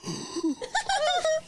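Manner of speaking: sharp high gasp of surprise; startled gasp. Retold or imitated. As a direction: suck in air, shocked.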